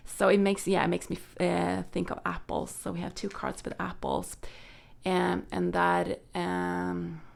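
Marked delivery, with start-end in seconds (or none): none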